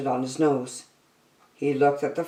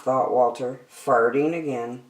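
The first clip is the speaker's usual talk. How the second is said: plain reading voice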